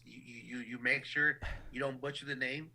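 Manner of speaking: normal talking voice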